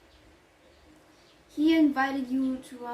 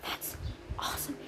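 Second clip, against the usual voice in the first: that's awesome said much softer, almost whispered; hushed; 10 dB quieter than usual. Shown as a demonstration. Nanny catching herself on a secret call, hushed. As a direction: rein it back in to a hush. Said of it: "I mean".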